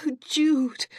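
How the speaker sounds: high, loud tearful cry; weeping with relief. Acted. Cassandra crying with relief at Judith's news. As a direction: break into relieved tears. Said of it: "Cassandra was weeping in earnest now".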